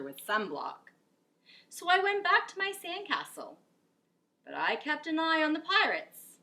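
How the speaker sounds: lively storybook narration; animated picture book reading; reading to children. A narrator's reading. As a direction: read it with expression to a class. Reads picture book aloud.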